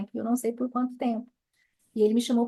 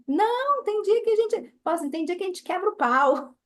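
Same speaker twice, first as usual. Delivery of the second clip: excited; 8 semitones higher, 3 dB louder than usual. Real, not acted. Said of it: "emphatic correction".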